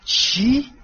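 sharp high burst of disbelief, voiced apart from the narration; Harry can't believe what he's hearing; disbelieving what?. Harry reacts to Percy's words with stunned disbelief. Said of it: "هری با ناباوری گفت".

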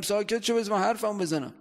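half-hearted yell to get the floor in an argument; mock yelling. (داد زدنِ نمایشی و ملایم سر رفیق)

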